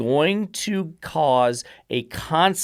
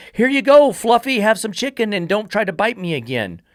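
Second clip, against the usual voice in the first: mock sugary voice; 7 semitones higher, 3 dB louder than usual. Mocking a coddling dog owner.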